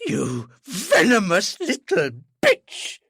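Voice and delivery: loud, high, slow strangled fury; father choking on his fury at his daughter; choking rage. Acted. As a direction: spit it out as if choking. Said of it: "as if he was trying to choke himself".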